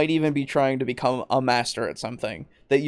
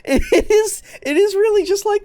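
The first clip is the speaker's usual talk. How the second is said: exasperated; 5 dB louder than usual. Real, not acted. Still laughing, exasperated.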